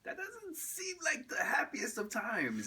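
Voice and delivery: high, slow mock-concerned voice; imitated doubtful onlookers. Audience reacting to his neighborhood stories, imitated high-pitched doubt. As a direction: draw it out high, imitating sceptical listeners. Voicing people's reaction to show.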